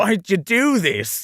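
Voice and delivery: high, drawn-out anguished line reading; dramatic pleading. Acted. Actor redoing the line after director's note, dramatic pleading. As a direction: plead it slowly, hammed-up. Retake after accent was criticised.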